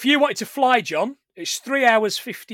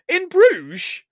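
incredulous; 10 semitones higher, 5 dB louder than usual. Retold or imitated. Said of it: "And Ray says".

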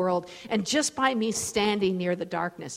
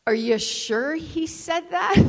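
high, drawn-out questioning mom; doubting mom; 7 semitones higher, slower than usual. Retold or imitated. Mom questioning her child about what God said, doubting. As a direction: stretch it out higher, querying. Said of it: "my mom was like".

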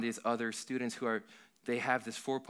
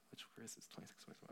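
whisper; 22 dB quieter, much faster than usual. Real, not acted. Searching for the verse.

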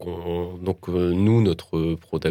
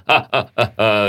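hammed-up evil villain laugh; machiavellian laugh; 4 semitones higher, 6 dB louder than usual. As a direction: mustache twirling villain cackle. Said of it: "rire machiavélique".

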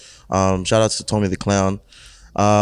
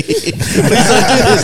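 laughing plea; 12 semitones higher, 5 dB louder, slower than usual. Real, not acted.

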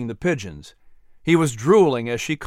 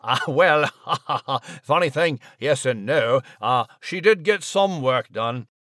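ordinary delivery

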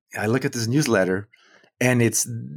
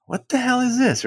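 shocked, much higher outburst; outraged disbelief; 13 semitones higher than usual. Retold or imitated. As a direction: blurt it out in stunned outrage. Reading insulting newsletter, outraged.

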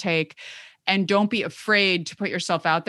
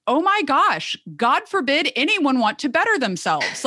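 exasperated outburst, pitched way up; high, sarcastic retold rant; losing her marbles; 8 semitones higher than usual. Fed-up therapist snapping at doubting colleagues, exasperated. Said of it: "kinda lost my marbles a little bit".

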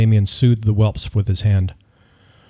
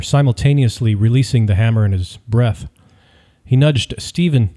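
usual speaking voice

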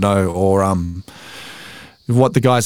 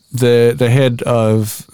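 ordinary talk

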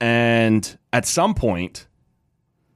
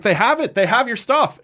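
excited, high. Retold or imitated. Guy excitedly brings the beer.

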